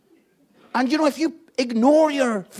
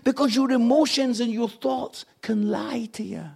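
ordinary delivery

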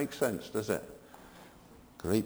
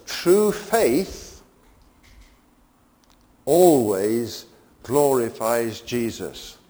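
emphatic preaching; 7 dB louder, much slower than usual. Acted. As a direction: stretch each word out as the climax. Climax of sermon point.

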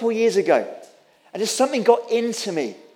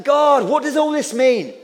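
retold yelling prayer; 4 semitones higher than usual. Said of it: "I was yelling".

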